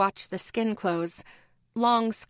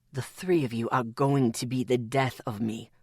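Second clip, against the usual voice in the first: deep, tired man's voice; weary low voice; 9 semitones lower than usual. A narrator's reading. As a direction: sigh it out low. Atticus sighing at them.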